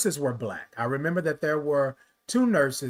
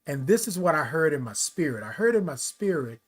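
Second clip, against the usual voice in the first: ordinary talk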